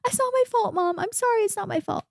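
put-on high, pleading whimper of a small boy; imitating a crying boy. Boy crying to his mom after a bad putt, high pleading whimper. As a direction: beg your mom like a kid in tears. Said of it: "he was literally crying".

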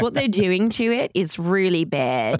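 slow, hammed-up Australian accent. Cohost imitates trainer's warning about corn, Australian accent. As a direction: put on an Aussie trainer's accent, drawn out. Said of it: "She said it in Australian accent. Can you do it?".

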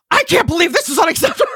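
hammed-up harsh customer rant; mock pissed complaint. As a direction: play at being furious with the company, grinning through it. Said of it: "So I'm gonna sound really pissed and harsh".

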